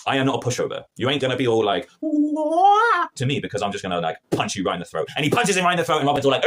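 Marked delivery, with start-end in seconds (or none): 2.0-3.1 high mock crybaby wail; a hammed-up whiny "wah". [name] mocking Robin as a crybaby, mock wail.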